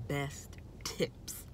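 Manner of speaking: whispered aside, no voice. Acted. Vlogger whispers her tips to viewers in a secret aside. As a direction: breathe the words as a secret aside.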